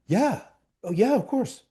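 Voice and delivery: high, breezy mock agreement; mock Ron agreeing. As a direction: brush it off with easy yes. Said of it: "And he said".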